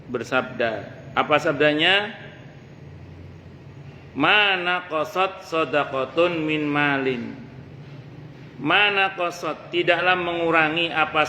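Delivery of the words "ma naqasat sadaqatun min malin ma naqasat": slow, measured Arabic recitation; hadith recitation. Acted. Preacher reciting a hadith chain to the congregation, slow and measured. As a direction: intone the chain of narrators slowly.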